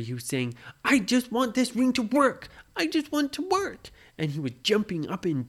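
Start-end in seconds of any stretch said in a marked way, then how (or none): 0.8-3.9 high, loud frustrated whine; angry whine. Acted. Parent voicing Brady jumping in frustration, high angry whine. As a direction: stamp out a child's tantrum. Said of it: "Brady was getting so angry".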